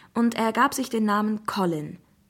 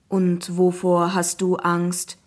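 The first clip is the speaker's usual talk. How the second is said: slow, monotone computer question; talking app; 3 semitones lower, 3 dB louder, slower than usual. Acted. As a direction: ask evenly, like a machine. Zeus app questions the boy. (langsame, monotone Computerstimme)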